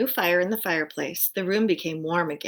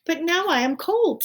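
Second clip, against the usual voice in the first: owl voice; 10 semitones higher, 5 dB louder than usual. Acted.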